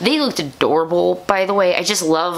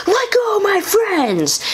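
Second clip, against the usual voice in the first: very high-pitched hero's shout, hammed up; mock heroic cry; slower than usual. Preston defending his friends from Sledge, mock heroic cry. Acting Preston grabbing a sword.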